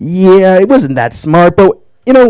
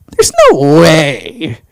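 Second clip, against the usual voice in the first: drawn-out, incredulous delivery; retold disbelief; much slower than usual. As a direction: stretch it out in mock disbelief. Retold disbelief at failed email.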